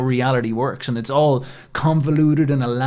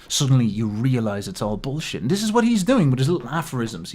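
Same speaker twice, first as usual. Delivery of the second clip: ordinary talk, nothing marked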